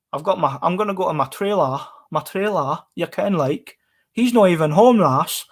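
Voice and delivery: imitating a lilting far-north Scottish accent; Highland Scottish accent. Streamer mimics the accent a viewer dislikes, exaggerated Highland Scottish. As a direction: put on a singsong Highland Scots voice. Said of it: "far north Scottish accent".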